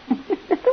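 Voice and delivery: short, high laugh; amused laugh. Acted. Nurse teased by her bossy patient, amused laugh. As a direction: laugh lightly at being bossed. Teasing about being bossed.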